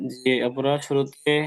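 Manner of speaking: ordinary delivery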